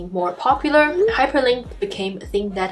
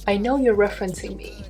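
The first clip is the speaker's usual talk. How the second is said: second talking web page. Acted. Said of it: "web pages are real life creatures".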